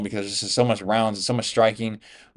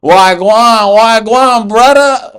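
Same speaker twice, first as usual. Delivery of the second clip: put-on loud, high Jamaican accent greeting, hamming it up; mock Jamaican accent; 10 semitones higher, 10 dB louder, much slower than usual. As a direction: shout a playful Jamaican patois hello, imitating the fighter. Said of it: "he was speaking in Jamaican accent".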